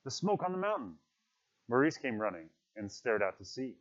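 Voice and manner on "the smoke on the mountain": alarmed cry. Acted. Boys spot smoke on mountain.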